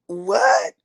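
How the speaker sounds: high, rising astonished what; floored by the news; father hearing she is an author, amazed; dad's amazed "what?". Retold or imitated. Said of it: "he was just like".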